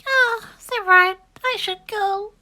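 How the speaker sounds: high, slow, resigned little voice; dejected duckling. Acted. Ugly duckling decides to leave, high and resigned.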